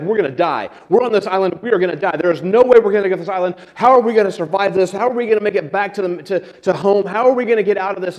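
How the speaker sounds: worked-up, high-pitched mock panic; panicking. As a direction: play a castaway in a mounting panic. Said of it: "One of them is panicking. He is, he is very upset".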